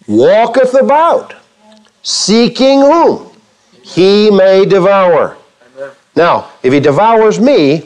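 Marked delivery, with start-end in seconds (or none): 0.1-5.4 slow ominous quote. Real, not acted. Dramatic reading of Peter's warning.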